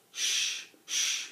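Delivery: hushing the rooster; urgent shush. A narrator's reading. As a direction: shh it out. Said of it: "he said to the rooster".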